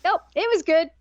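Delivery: imitating the smitten boy, delighted. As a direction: play it cool but thrilled. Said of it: "he's like".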